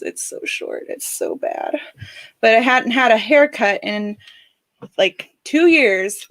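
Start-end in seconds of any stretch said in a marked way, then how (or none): 0.0-1.8 soft unvoiced whisper. Real, not acted. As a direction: whisper it sheepishly. Whispered complaint about haircut.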